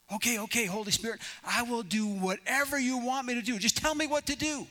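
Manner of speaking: high-pitched eager pleading; acted desperate man agreeing to anything; Jack.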